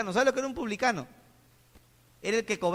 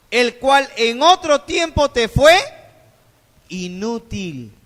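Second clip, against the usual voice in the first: emphatic preaching; 3 semitones higher, 11 dB louder, much slower than usual. Acted. Reads verse with force.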